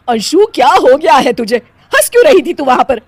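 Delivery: loud, high, outraged questioning; angry scolding. Acted. Mother-in-law after the condolence visit, angry scolding. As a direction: demand an explanation furiously.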